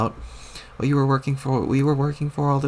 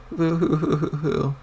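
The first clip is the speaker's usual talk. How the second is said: mock sobbing; slower than usual. Ghost weeps over lost John.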